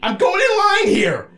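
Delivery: high, loud, stretched-out protest; exasperated shout. Real, not acted. Storyteller insisting he is going in line, shouting. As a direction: shout over the interrupters, slow and high. Shouting over interruptions from the others.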